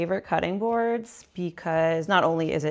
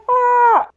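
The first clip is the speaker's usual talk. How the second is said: pained cry; 12 dB louder than usual. Real, not acted. Eyes stinging from the onion.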